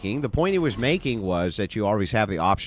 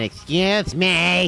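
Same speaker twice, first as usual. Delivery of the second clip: slow, high mock lady voice; the interrupting woman, mocked high and slow; mock prim woman; 11 semitones higher, 5 dB louder, much slower than usual. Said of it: "She said".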